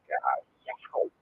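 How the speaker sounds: high yelp of sudden pain. Retold or imitated. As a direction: yelp like a nerve just got hit. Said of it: "it would be like".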